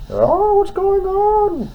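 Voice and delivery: very high, slow wondering question; surprised. Acted. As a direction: draw it out in wonder. Aside to child listener.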